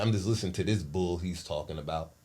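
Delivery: low, soft imitation of stepfather; dismissive reply. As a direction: say it low and quietly, brushing it off.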